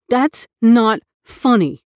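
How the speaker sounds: put-on a teased boy snapping back; hurt anger. A narrator's reading. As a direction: make it sound cross but wounded.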